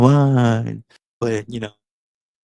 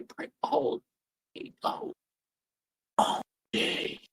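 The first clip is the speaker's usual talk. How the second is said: high, soft, half-whispered confession; hushed confession; 7 dB quieter than usual. Acted. Confessing to the support group in a high hush. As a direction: lean in and whisper the sordid memory.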